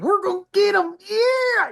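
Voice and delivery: high-pitched, hammed-up pursuit cry; mock gung-ho police. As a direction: whoop like cops starting a chase. Imitating police with lights and sirens.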